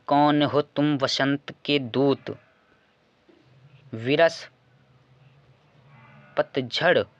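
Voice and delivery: slow poem reading with long pauses; verse recitation. Acted. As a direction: recite measured and emphatic, phrase by phrase. Reads the next poem aloud.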